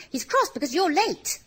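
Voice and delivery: hint of a worried little engine; Percy voice. A narrator's reading. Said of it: "said Percy".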